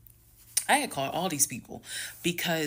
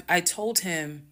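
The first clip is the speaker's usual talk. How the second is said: ordinary talk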